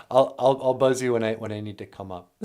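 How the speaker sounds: ordinary talk, nothing marked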